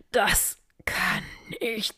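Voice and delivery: strangled, slow, broken-off words; choking. A narrator's reading. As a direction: force the words out through a crushed throat. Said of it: "brachte er kein Wort heraus". (erstickt, abgebrochene Worte)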